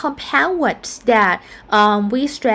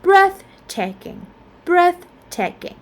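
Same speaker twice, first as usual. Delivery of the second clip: "breathtaking" with BREATH raised high and slower; stress on breath-; 7 semitones higher than usual. Shown as a demonstration. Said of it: "we stress in the first element".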